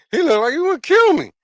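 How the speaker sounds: high, loud comic disbelief; amused exclamation. Real, not acted. Telling viewers about a stranger at the store, amused exclamation. Repeats punchline between laughs.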